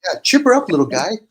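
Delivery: hammed-up Leave it to Beaver encouragement; mock pep talk. Host mocks cheering up a sulking listener, high and peppy. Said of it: "You say it nicely".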